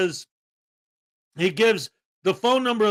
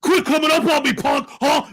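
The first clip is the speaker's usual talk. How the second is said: higher, louder mock snarl at the business owner; mocking angry streamer; 7 semitones higher, 5 dB louder than usual. Imitating the streamer snapping at the business owner, mock angry. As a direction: sneer it like a hothead picking a fight. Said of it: "he's like".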